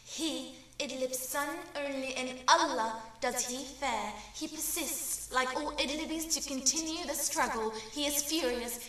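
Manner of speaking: ordinary talk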